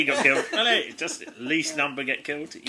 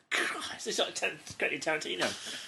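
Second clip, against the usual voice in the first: breathy, much softer amused aside; hushed aside; 10 dB quieter than usual. Real, not acted. Commenting on the violent story, a soft breathy aside. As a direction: drop to a breathy hush, amazed.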